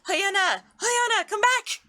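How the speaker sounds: put-on high-pitched call after a runaway child; mother calling. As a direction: call the kid back, high and urgent. Mother calls running daughter by name.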